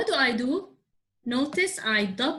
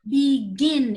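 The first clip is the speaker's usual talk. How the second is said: begin, louder, with a strong GIN; stress on second syllable; 5 dB louder than usual. Shown as a demonstration. Teacher explaining to the class, begin stressed on GIN. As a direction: hit GIN hard in begin. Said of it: "It means the second syllable is stressed".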